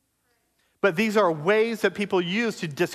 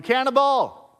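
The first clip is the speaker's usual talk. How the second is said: a higher, louder street heckle; mock yell; 7 semitones higher, 5 dB louder, slower than usual. A stranger yelling at a believer on the street, mock yell. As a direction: shout it like a stranger jeering. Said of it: "someone yells at you".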